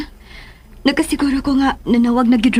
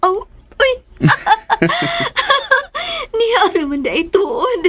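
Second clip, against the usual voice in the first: breaks into laughter, drawn out and slow; laughing; 8 semitones higher, slower than usual. Acted. Woman laughing at her lover's teasing about age. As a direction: laugh through the words, amused and flattered.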